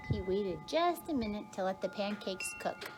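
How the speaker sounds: plain reading voice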